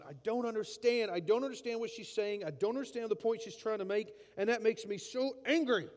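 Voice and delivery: exasperated, rising to angry; mock-frustrated rant at a spouse. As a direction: complain hotly about not understanding. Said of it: "you're like, going".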